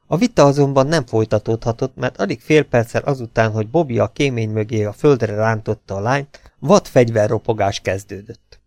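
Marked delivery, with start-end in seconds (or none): none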